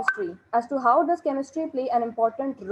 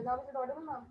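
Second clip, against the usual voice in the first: barely audible; 13 dB quieter, faster than usual. Real, not acted. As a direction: still too quiet. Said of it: "better, but not very properly".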